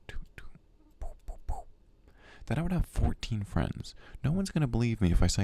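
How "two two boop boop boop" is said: whispered counting. Real, not acted. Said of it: "hold on, let me count".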